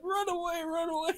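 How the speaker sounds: very high mock yell; imitated fleeing recruits. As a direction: cry it out like recruits scattering. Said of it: "they were like".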